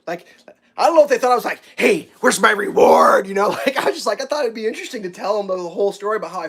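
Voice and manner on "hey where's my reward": loud, drawn-out put-on demand; exaggerated slow, loud claim; mock reward demand. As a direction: playfully demand payment, hammed-up.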